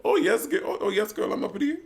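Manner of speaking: very high-pitched, affected drag queen impression; Shaquita. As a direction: put on a posh, high voice. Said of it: "Shaquita's like".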